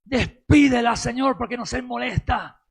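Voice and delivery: loud, insistent demand opening on a burst; acted complaint of bothered disciples; annoyed disciples' plea. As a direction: press for her to be sent away, loudly at first. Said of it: "los discípulos que deje-, decían".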